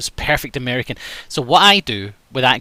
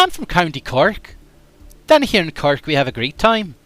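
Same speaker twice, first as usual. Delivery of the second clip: higher, lilting Cork accent; cheerful Cork accent; 4 semitones higher than usual. Shown as a demonstration. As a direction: put on a Cork lilt. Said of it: "that's pretty good".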